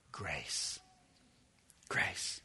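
breathy whisper, low and slow; whispered grace. Real, not acted. Preacher to congregation, whispering grace with awe. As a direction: breathe the word like a treasure. Preacher savoring key word.

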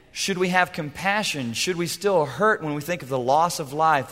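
ordinary talk

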